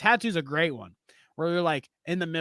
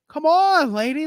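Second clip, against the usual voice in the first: higher, louder exasperated plea; mock exasperated; 9 semitones higher, 5 dB louder than usual. Trainer pleading with the angry customer, exasperated. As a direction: plead with a tired shake of the head. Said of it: "she's like".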